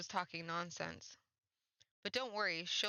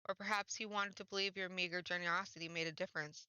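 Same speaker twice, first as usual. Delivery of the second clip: ordinary delivery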